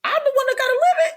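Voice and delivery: very high, loud comic wail; exasperated squeal. Acted. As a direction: squeal it as the punchline of a rant. Climax of comic rant about herself.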